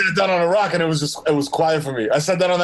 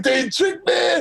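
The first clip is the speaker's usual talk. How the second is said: mock outrage; 9 semitones higher, much slower than usual. Acted dupe, shouting repeat.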